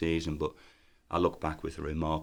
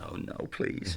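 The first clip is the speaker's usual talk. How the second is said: embarrassed plea; 7 dB quieter, much slower than usual. Retold or imitated. Dreading being recognised in retold scene.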